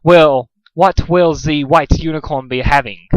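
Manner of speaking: slow, lightly French waiter voice; polite waiter with French lilt; French accent. Acted.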